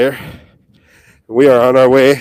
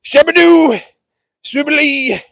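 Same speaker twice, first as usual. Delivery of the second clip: much higher, playful nonsense calls; goofy high shouted gibberish; shouting nonsense; 11 semitones higher, 4 dB louder, slower than usual. Real, not acted. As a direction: holler silly sounds at the herd. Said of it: "Just shouting random things".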